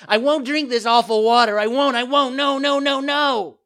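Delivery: put-on very high, loud childish tantrum; bursting out crying. A narrator's reading. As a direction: wail the refusal, building to a shriek. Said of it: "bursting out crying".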